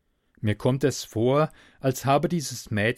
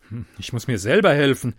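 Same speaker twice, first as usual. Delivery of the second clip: ordinary delivery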